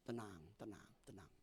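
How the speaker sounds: soft, low soothing tenang; calming himself down gently; hushed self-soothing; calm yourself. Shown as a demonstration. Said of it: "Itu perlu penguasaan diri".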